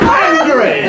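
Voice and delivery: loud, high roar of anger; explosive demonstration of anger; angry shout. Shown as a demonstration. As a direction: bellow it with full fury. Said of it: "anger is like".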